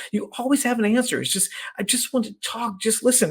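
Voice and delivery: high, put-upon woman insisting it's not the nail; whiny woman voice. Retold or imitated. Coach retelling a comedy video, high exasperated wife voice. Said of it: "She goes".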